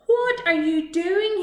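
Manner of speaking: big bully robot roaring. Acted. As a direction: bellow it at the little robot. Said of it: "he roared at Bimbot".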